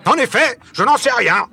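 high, loud angry admission; shouting. A narrator's reading. Barbicane shouts back at Michel, angry shout. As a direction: shout it right back at him. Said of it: "crie Barbicane".

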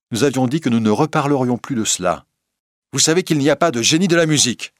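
high, loud, sharp reproof; curt, dry tone. A narrator's reading. Christine reproves the old lady for her talk, curt. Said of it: "d'un ton sec".

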